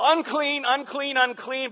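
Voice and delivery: loud high cry, falling on each word; ringing leper's cry; leper's shout. Pretend. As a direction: call out the warning, loud and high. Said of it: "he's got to yell".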